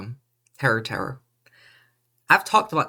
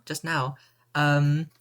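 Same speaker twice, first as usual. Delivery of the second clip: ordinary talk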